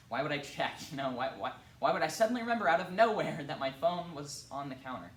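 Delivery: loud, high-pitched burst of protest; agitated protest. Acted. Narrator protests in rising distress.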